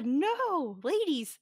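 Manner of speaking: high, loud scolding no; loud horrified protest; mock outraged cry. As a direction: cry out in mock horror at the screen. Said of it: "That's my note".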